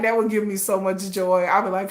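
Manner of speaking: ordinary talk, nothing marked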